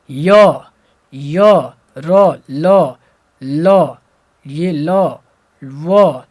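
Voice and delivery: य, र, ल, व called out loud, one by one; teacher loudly demonstrating each of य, र, ल, व; letter sound demo. Shown as a demonstration. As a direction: sound out य, र, ल, व singly, strongly projected. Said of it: "जब भी इसका उच्चारण हम करते हैं".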